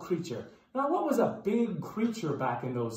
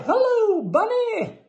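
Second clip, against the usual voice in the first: high greeting; 14 semitones higher, 7 dB louder than usual. Pretend.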